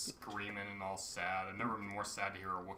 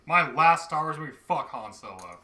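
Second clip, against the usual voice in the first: loud, high burst of mock contempt; mock fed-up actor; 7 semitones higher, 16 dB louder than usual. Co-host voicing the actor who hated his role, mock disgusted. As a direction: blurt it loudly, imitating a sick-of-it star.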